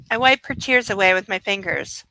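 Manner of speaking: ordinary delivery, nothing marked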